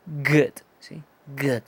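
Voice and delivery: Good said loud and crisp; teacher modelling English; model word. Shown as a demonstration. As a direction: model Good clearly for the class. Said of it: "La que sigue".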